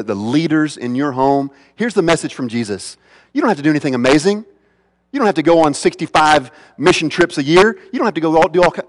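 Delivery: usual speaking voice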